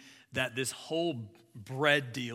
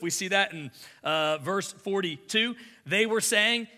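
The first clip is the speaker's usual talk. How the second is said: ordinary talk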